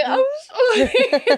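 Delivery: very high, slow, laugh-cry sorry; laughing half-sob sorry. Retold or imitated. Apologising again to the kind driver, laughing through tears. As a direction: squeak it out between laughter and tears.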